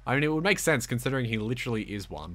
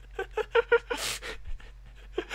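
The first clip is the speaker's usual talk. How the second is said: very high-pitched, breathless giggles; giggling fit. Real, not acted. Player cracking up while doting on the dog, high giggling. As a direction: burst into squeaky, helpless giggling.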